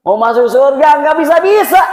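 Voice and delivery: high exasperated shout; hammed-up frustrated outburst, rising high; acted exasperation. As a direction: complain loudly as if thwarted yet again. Man exasperated at being called back.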